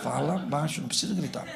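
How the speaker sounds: low, soft shushing; softly restrained, under the breath; hushed husband. Retold or imitated. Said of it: "o marido fala assim, fala baixo".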